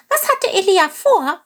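high, light bird voice; small eager bird asking; little bird voice. Acted. Little bird Chilp asks.

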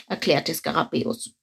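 plain reading voice

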